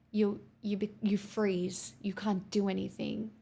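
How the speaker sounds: ordinary talk, nothing marked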